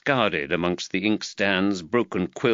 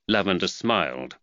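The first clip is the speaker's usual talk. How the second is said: plain reading voice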